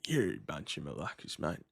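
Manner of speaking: put-on low, soft Aussie accent. Streamer voices what he should have said, Aussie accent. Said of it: "said it in the Aussie accent".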